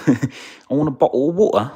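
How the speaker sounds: exaggerated British, high and loud; hammed-up high British voice; British accent. Shown as a demonstration. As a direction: belt it out in mock British. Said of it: "in a British accent".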